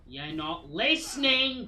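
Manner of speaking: high, loud, slow yell; furious shout. Real, not acted. Gamer yelling at a game that won't listen, furious. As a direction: scream it at something that won't obey.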